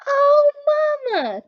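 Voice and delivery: high, loud relieved cry. Acted. Maxwell seeing his mother, crying out in relief. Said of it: "cried Maxwell".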